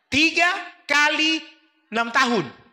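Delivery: ordinary talk, nothing marked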